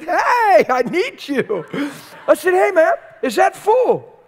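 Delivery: high, loud call across the store; calling out loudly. Retold or imitated. Preacher calling out to the Walmart kid for help. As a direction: hail the kid passing by.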